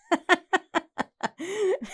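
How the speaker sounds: long high giggling fit; high, drawn-out laughter; real laugh. Real, not acted. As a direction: burst out giggling. Cracking up at friend's remark.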